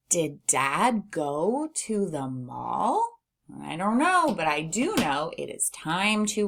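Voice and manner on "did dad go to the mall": slow read-aloud question rising on "mall"; question intonation. Shown as a demonstration. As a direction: ask "Did Dad go to the mall" slowly, lifting the last word. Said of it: "we'll read this as a question".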